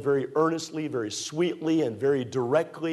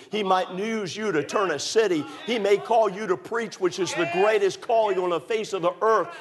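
preaching; 7 semitones higher than usual. Acted. Preacher urging young people to serve God, full-force preaching. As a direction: lift the crowd with the call.

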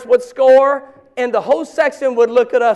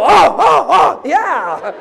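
louder, excited churchgoer oh-oh; eager response; 3 semitones higher, 5 dB louder, much slower than usual. Pretend. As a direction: answer the pastor like a stirred listener. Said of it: "So the pastor says".

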